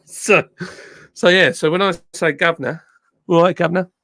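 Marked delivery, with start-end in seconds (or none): 2.3-3.9 put-on "Governor" said "guvnuh", the older southern English way; old southern English accent. Shown as a demonstration. Streamer showing chat his old southern English accent. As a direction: show the southern English accent he once had. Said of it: "my accent used to be a bit more like that".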